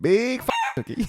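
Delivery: hammed-up high-pitched mimicry; comic impression. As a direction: belt it out as a comic character. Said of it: "an impression I can do".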